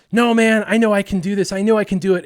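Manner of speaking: high, loud protest; stubborn insistence. Acted. Embarrassed kid insists to brother, loud and high. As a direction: argue it urgently, refusing to back down.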